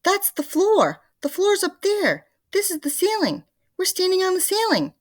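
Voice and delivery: very high, loud frantic woman; a horrified old woman shrieking; panicked Mrs Twit. A narrator's reading.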